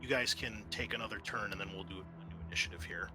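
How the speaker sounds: ordinary talk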